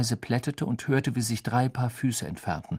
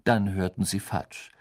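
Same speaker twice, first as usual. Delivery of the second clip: plain reading voice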